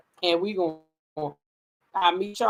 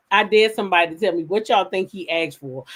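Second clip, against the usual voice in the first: ordinary delivery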